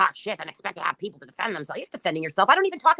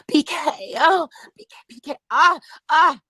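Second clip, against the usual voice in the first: mock crying; 7 semitones higher, 7 dB louder, slower than usual. Mocking a commenter by fake-crying over BK, loud and high.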